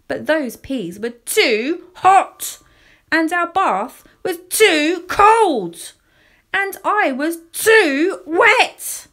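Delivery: put-on childish grumble rising to a wail; building exasperation. A narrator's reading. Child's escalating list of complaints.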